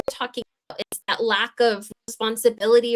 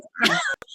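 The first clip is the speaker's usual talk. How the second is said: a quick giggle, high; short high laugh. Real, not acted. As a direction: let a little laugh slip. Laughing at friends' joke.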